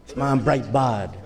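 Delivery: low, mock-awed bystander; lightly voiced impressed onlooker. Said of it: "might look at this and say".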